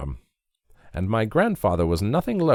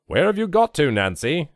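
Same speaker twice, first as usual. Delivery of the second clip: booming, high shout up the stairs; bellowing call; 8 semitones higher, 5 dB louder than usual. A narrator's reading. Grandfather bellowing for Nancy upstairs, loud booming call. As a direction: bellow for her like a bull. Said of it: "bellows like a bull".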